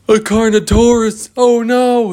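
high, loud terrified shout; terrified cry. Acted. Kids seeing the Carnotaurus behind them, high loud fear. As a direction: cry out in horror.

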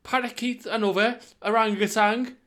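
high, loud, hyped-up; excited. Real, not acted. Lad pitching a Chester Zoo feast, high excited shout. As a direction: sell the zoo plan with wild glee.

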